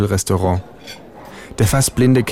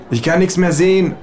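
high, raised call; 9 semitones higher than usual. A narrator's reading. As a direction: call out in distress. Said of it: "rufe ich".

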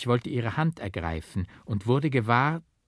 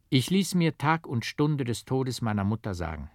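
usual speaking voice

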